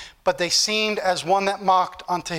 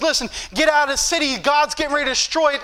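higher-pitched, pressing warning; urgent warning; 6 semitones higher, faster than usual. Acted. Lot warning his family to get out, urgent warning. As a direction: warn them with acted urgency. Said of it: "I need to start warning my family".

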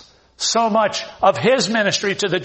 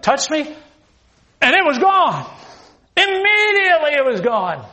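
higher, rushing, building to wonder; excited healed leper; 6 semitones higher than usual. Acted. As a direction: tell it breathless with joy.

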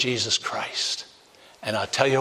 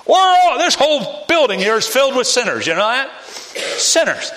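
much higher and louder, hammering it home; preaching shout; 11 semitones higher, 5 dB louder than usual. Real, not acted. Preacher shouting at the congregation that all are sinners. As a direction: call the whole room out as sinners.

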